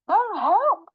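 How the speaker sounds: high, loud cry for help; hammed-up storybook fright; alarmed fox. Fox sees Gruffalo, alarmed.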